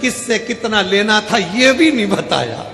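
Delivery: acted tearful protest; mock crying son. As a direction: complain loudly as a pretend weeping son. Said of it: "बेटा रो रहा था".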